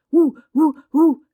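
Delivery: high, loud, wide-eyed cries; a child's thrilled outcry; excited cry. A narrator's reading. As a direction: call it out high in short eager bursts. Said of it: "cria-t-il".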